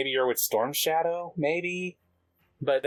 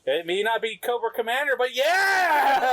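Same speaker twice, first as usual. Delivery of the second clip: excited cheer; 11 semitones higher, 4 dB louder than usual. Acted. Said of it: "They're like".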